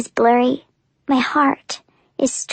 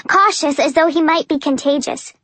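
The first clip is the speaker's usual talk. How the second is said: ordinary delivery, nothing marked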